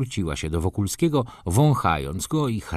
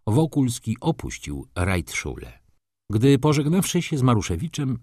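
ordinary delivery, nothing marked

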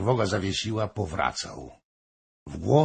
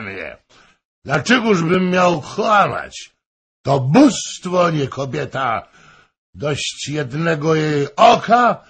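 much higher and louder, swelling to a shout; passionate drunken boast; 8 semitones higher, 9 dB louder than usual. A narrator's reading. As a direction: boast of being a Don Juan. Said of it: "zawołał". (namiętny, pijany, głośny zachwyt)

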